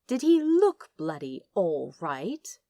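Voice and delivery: ordinary delivery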